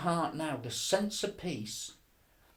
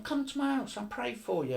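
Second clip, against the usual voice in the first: lightly voiced pushy friend, pitched higher; mock his eager invite, higher up; mimicking Alan; 9 semitones higher than usual. To Chris, Alan pushing church, mimicked high. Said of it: "He was going".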